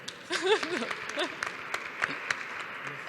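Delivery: a long, high nervous laugh on stage. Real, not acted. First time on stage, a nervous laugh. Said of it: "because I'm very nervous".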